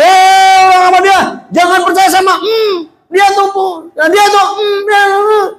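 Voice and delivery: very high heckler's shout; yelling. Acted. Blind man warning passers-by against the Prophet, yelling. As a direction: yell high and halting, breaking off. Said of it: "Dia teriak-teriak lagi".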